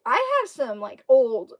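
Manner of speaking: ordinary talk, nothing marked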